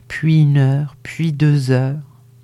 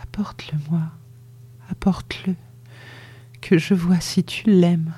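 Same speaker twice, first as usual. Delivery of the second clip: put-on dying mother whispering to bring her the baby; murmured very low; 3 semitones higher, 4 dB quieter than usual. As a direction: breathe it out barely audible. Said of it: "elle murmura tout bas".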